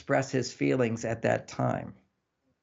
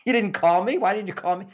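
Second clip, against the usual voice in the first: raised pitch, lightly acted accusation; mock upset complaint; 5 semitones higher, 4 dB louder than usual. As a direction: play a partner griping about a missed call. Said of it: "this is stuff we get upset about".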